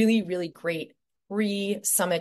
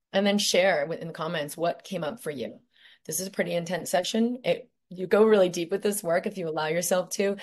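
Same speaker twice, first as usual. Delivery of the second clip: ordinary talk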